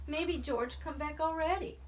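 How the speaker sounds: high, anxious hopeful voice; hope against your fear; frightened. A narrator's reading. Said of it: "in a frightened voice".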